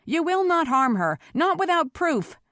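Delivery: high, forceful forbidding; protective cry. A narrator's reading. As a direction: defend her loudly against a mob. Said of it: "he cried".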